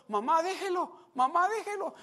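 put-on high, loud begging child; boy begging his mother to stop; child pleading. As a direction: tug at mom, pleading high.

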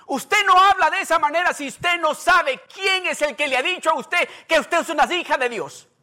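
high, loud, fervent preacher; full-force preaching. Acted. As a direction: preach it out to the congregation. (predicación a todo pulmón)

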